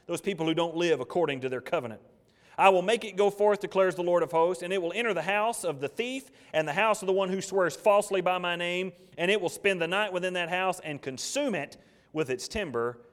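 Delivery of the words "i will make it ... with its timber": loud, high, full-force pulpit reading; preaching scripture. Acted. Preacher thunders God's word to the congregation, loud and high. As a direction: proclaim the verse to the whole church.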